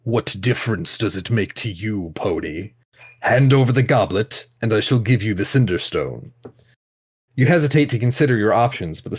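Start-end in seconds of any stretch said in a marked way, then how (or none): 0.0-6.3 sneering sultan. Acted. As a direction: sneer it down at the pony. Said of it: "the Sultan of Cinders simply sneers".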